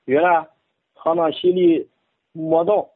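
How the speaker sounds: Bible reading in Henan dialect; measured Henan dialect recitation. Shown as a demonstration. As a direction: read scripture in a rustic Henan accent. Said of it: "用河南话".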